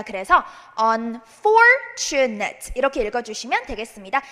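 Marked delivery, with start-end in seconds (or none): 0.8-2.7 "unfortunate" with the beat on FOR; stress on FOR. Shown as a demonstration. Teacher showing where the stress goes, FOR emphasised. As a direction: slowly lean on the FOR in "unfortunate". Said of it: "강세를 주시면 됩니다".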